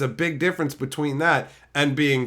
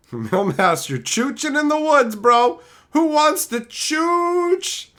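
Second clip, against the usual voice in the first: goofy chat voice; 13 semitones higher, 5 dB louder, slower than usual. Pretend.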